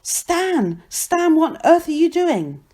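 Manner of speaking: high, alarmed cry; horrified. A narrator's reading. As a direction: call out aghast. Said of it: "a pair of horrified voices".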